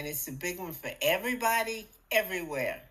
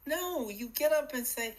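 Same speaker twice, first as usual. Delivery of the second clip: normal talking voice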